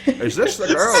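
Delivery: abandoned joke impression, high and slurred; Sean Connery. Priest asking about the girl, as a joking Connery impression. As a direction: briefly try a famous-actor impression. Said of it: "that kind of Sean Connery".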